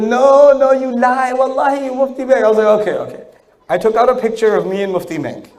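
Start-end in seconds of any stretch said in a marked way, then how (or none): 0.0-2.4 insistent woman. Retold or imitated. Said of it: "she goes". 2.8-3.2 resigned okay. Retold or imitated. Giving up to the woman.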